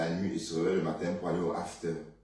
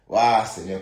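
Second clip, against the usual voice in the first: loud exclamation; 10 dB louder, slower than usual. Real, not acted. Exclaims in disbelief at friend.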